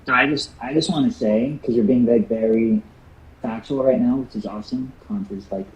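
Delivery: muffled, like talking into a pillow. Real, not acted. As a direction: voice buried and muffled. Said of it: "speaking into a pillow".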